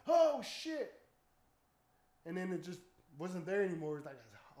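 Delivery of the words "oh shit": loud, high shocked outburst; startled exclamation. Retold or imitated. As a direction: jump back in fright. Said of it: "And like".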